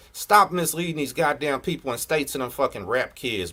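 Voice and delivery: ordinary delivery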